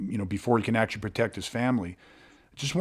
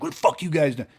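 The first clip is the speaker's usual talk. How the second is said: mock aggressive challenge, pitched up; posturing; 5 semitones higher than usual. Man at his car mocking a posturing challenge, mock aggressive. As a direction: puff up and throw it at them like a threat. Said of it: "He didn't say it like".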